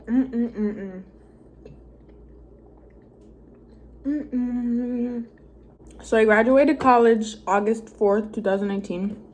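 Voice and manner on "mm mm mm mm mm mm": mm while chewing; pleased humming through food; savoring hum. Real, not acted. Eating noodles on camera.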